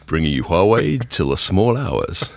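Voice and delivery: slow, put-on late-night DJ croon. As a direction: stretch it out like a smooth small-hours radio host.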